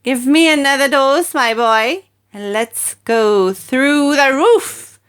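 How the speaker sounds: demanding hag. A narrator's reading. Grandma to George, screeching demand. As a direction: demand it gleefully.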